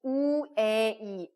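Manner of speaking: 우에이 stretched very slowly and loud; original sound. Shown as a demonstration. Teacher reveals the underlying 우에이, slowly. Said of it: "원래 발음은".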